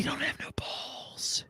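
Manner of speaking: hushed mocking whisper; stage whisper. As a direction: whisper it like a conspiratorial jab.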